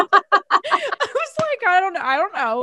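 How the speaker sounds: high, laughing, baffled; laughing confusion. Retold or imitated. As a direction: laugh it out in disbelief.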